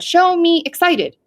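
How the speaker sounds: put-on game leader prompting a child slowly; foreman's command. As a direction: call the command out, stretched. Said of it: "if I said".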